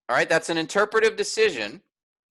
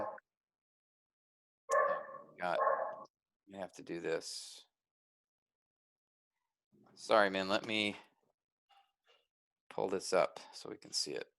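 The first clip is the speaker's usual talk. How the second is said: low, much softer working mumble; quiet aside; 9 semitones lower, 12 dB quieter than usual. Real, not acted. Teacher apologising while pulling up the text, quiet aside. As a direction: talk softly to yourself while busy.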